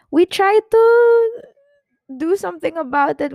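words cracking as tears come; voice breaking. Real, not acted. Tearful talk about pain.

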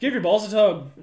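loud Canadian hockey guy; Canadian accent. Pretend. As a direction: bark it like a hockey player. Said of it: "my Canadian accent".

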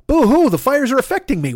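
high, hammed-up whining boo-hoo; really milk the self-pity, up high; whiny complaint. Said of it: "But I'm gonna say this".

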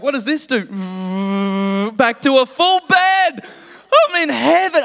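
squealing delight at the full bed; thrilled squeal. Real, not acted. Seat turns into a bed.

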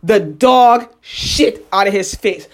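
drawn-out, deliberate repeat; slow emphasis. Real, not acted. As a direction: spell out "dog shit out of his face" slowly for effect.

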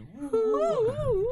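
high, drawn-out owl hoo; owl hoot. Shown as a demonstration. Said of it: "부엉이 소리 느낌이 있어야지".

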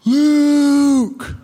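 long, drawn-out mock call; a father's far-off holler, acted out; distant calling. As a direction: imitate dad calling from far away. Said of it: "I hear a voice far, far away".

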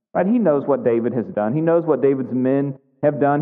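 usual speaking voice

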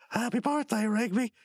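high put-on greeting; birthday cheer. Brother popping up at a birthday party, high mock cheer. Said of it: "and he goes".

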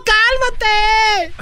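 mujer imitada, mucho más aguda y muy lenta; voz de ella. Retold or imitated. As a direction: alarga el cálmate en falsete. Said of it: "y ella". (imitando a la novia borracha en falsete)

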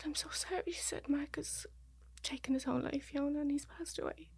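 high, hushed, voice half gone; retold sorrowful near-whisper; breaking terrible news. Retells death being broken to her.

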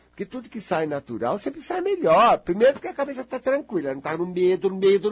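normal talking voice

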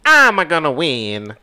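cartoon plumber voice, starting high; Mario. Podcast host doing a game character's victory line, in a Mario impression. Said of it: "do any voices".

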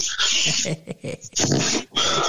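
long breathy cackle of real laughter; laughing at him. Real, not acted. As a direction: laugh hard at your mate's bad luck. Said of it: "so I was laughing at him".

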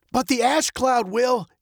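high, tense dad's reply; worried dad. A narrator's reading. Dad warns of the ash cloud, high and tense. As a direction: answer urgently, pitched well up.

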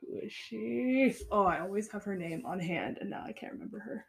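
usual speaking voice

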